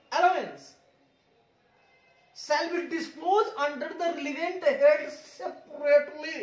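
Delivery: slow, word by word reading of the rule; slow dictation. Shown as a demonstration. Teacher dictating a rule to the class, slow and deliberate. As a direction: read the rule slowly for the students to note. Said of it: "teacher reads rule slowly".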